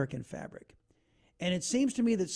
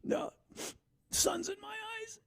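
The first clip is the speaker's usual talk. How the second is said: mock embarrassed denial; 9 dB quieter than usual. Retelling the ad's crying dude.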